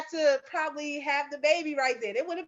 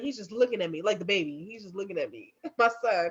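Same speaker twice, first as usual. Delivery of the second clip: ordinary delivery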